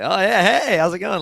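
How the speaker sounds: husband watching, chirpy and high; cheery husband quote. Retold or imitated. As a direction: greet them like nothing's wrong.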